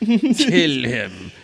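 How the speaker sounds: put-on loud villain command; hammed-up kill order; mock villain order. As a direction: bark it like a cartoon villain.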